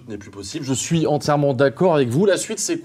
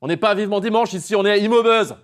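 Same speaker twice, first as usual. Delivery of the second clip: higher, fast mock telling-off; mock scolding; 8 semitones higher than usual. Host tells the clowning guest off, mock stern. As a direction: put the guest in his place, half joking.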